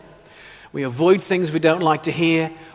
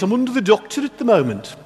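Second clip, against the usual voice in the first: put-on higher-pitched, lilting Gornal accent; Gornal village accent; 4 semitones higher, faster than usual. Boy from Gornal imitates how villagers spoke, in broad Gornal accent. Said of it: "Actually, they said it like this".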